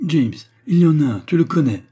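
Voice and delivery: high, loud exclamation; urgent insistence. A narrator's reading. George urges James toward the only way out, insistently. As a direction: press him hard, loudly.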